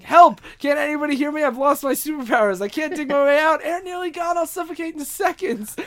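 high, trapped hero pleading; mock panicked cry for help. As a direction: act out a desperate call from a buried box. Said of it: "this is when Superman's banging".

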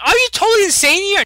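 high, loud incredulous outburst; mock outrage. Talia scolding over the bleeding Batman, mock outrage. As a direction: shoot way up in exaggerated disbelief.

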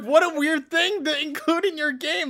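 high mock disbelief; incredulous. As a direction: play up baffled disbelief. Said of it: "I'm like".

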